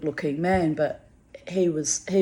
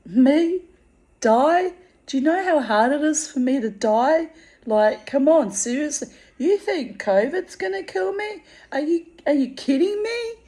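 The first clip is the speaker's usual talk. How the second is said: much higher and louder, incredulous; retold indignant disbelief; 9 semitones higher, 4 dB louder than usual. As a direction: scoff at the idea that covid could kill her. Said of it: "And I was like".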